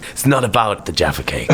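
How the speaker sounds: ordinary delivery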